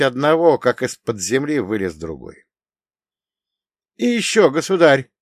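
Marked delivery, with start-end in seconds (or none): 4.0-5.1 frightened. Acted. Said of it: "добавил гонец".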